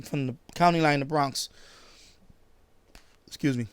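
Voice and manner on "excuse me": ordinary delivery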